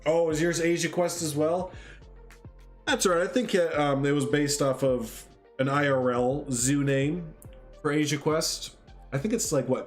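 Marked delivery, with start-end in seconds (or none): none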